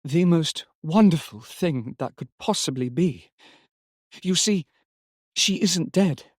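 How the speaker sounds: high, hushed exultation as if in a trance; exultant wonder. A narrator's reading. Laura tells John their dead daughter is near, rapt exultation.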